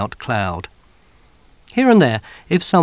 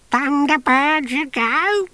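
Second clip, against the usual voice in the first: much higher, slow cracked crone voice; chortling old woman; slower than usual. Acted. Missus Tachyon taunting the soldier, high crone chortle. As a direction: chortle like a dotty old lady. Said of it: "she chortled".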